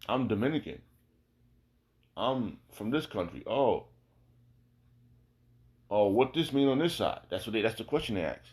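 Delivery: normal talking voice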